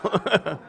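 short, soft amused laugh; real chuckle. Real, not acted. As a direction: chuckle before carrying on. Amused after own joke.

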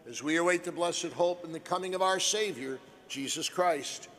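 usual speaking voice

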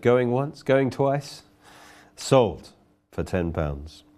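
slowing down to sold; auctioneer call. Retold or imitated. Auctioneer closing the sale with the gavel, slowing at the end. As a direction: drop and slow on the final word.

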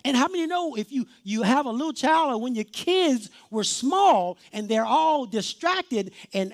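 usual speaking voice

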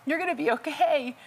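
high urgent shout; screaming. Retold or imitated. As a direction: call out to the drowning man. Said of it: "I just screamed".

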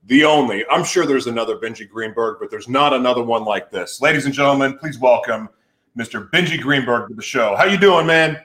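loud, big announcer introduction; show announcer. Acted. Host introducing the guest to the show, loud announcer style. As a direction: belt out a grand welcome, punching the opening words.

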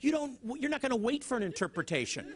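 ordinary talk

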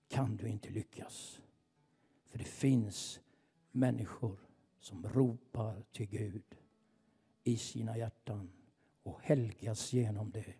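soft, low declaration to the enemy; hushed prayer with long pauses; quiet prophetic prayer. Real, not acted. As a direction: pray it down softly, each phrase weighed.

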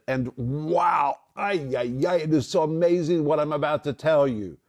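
mock Jesus bursting with wonder; amazed wow. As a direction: sound astonished at the news. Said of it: "You could translate it".

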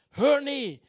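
high, loud call to a room. Retold or imitated. Passenger silencing twenty partygoers, calling out. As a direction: holler for everyone's attention.

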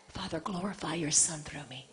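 low, very soft surrender; hushed prayer. Acted. Preacher yielding to the Father despite the burden, hushed prayer. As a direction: drop to an intimate, quiet plea to God.

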